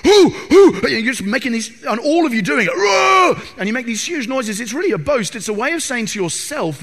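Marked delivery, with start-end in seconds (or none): 0.0-0.8 loud high grunting shouts; rugby huddle chant. Retold or imitated. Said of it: "like, roaring". 2.8-3.4 loud high roar; a huge huddle roar; team roar. Retold or imitated.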